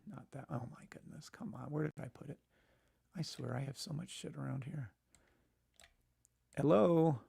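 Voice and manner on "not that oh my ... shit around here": muttering while searching. Real, not acted. Searching for a file.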